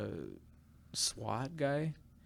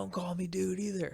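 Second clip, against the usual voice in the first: higher put-on voice of a curt cop; gruff cop; 8 semitones higher than usual. Cop snapping at the waffle vendor, mock gruff. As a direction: bark it like a touchy cop. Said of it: "He's like".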